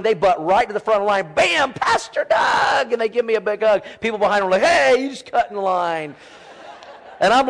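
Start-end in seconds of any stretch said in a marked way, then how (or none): 1.3-2.9 put-on high, drawn-out kid's call; excited child greeting. Voicing children rushing up to him. 4.6-6.2 mock complaint. Voicing people waiting behind the children.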